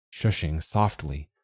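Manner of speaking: plain reading voice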